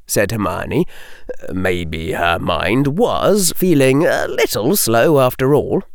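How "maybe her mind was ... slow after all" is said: plain reading voice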